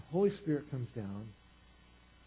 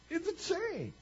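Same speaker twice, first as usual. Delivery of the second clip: excited exclamation. Retold or imitated. Repeating the delight over the chain ring, high exclamation.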